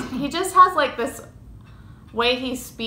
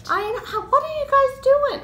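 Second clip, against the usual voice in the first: amazed. Real, not acted. Amazed at fast stitchers.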